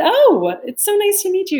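hammed-up thrilled gush at meeting him; mock gushing delight.

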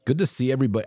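ordinary talk

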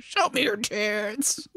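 slow, stretched-out goofy voice; weird voice. Retold or imitated. Interviewer repeating his crude question to band, mimicked in weird voice. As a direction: imitate him in a silly drawn-out voice. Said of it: "in this weird voice".

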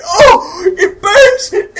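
high, loud agonised wail; screaming in pain. Acted. Orc grips his head in pain, hammed-up scream. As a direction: cry out as if burning, hammed-up. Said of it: "grip my head in pain".

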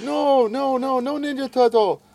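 high mimicked child voice; little boy protesting. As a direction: imitate a small child refusing. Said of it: "I love the way he says turtle".

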